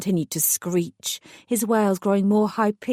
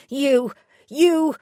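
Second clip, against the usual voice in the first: higher choked stammer; stammering fear; 7 semitones higher, 4 dB louder than usual. A narrator's reading. Prisoner stammering in fear at the godmother. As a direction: get stuck on the word, scared. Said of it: "he swallowed".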